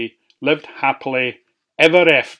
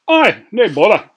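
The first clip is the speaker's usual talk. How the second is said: high, loud cheery workman; cheery Scots workman; manny voice; 7 semitones higher, 9 dB louder than usual. A narrator's reading. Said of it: "said the manny".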